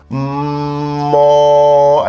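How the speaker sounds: long steady hum; held hum. Shown as a demonstration. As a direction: hold the hum on one note. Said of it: "and then an o".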